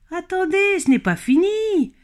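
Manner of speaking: very high-pitched fox voice; scolding fox. Acted. Hungry fox calling after two fleeing rabbits, scolding. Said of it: "gronda le renard".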